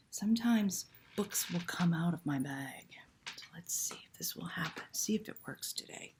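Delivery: hushed fortune teller patter; secretive magical whisper; mysterious whisper. Pretend. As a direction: whisper like a mystic.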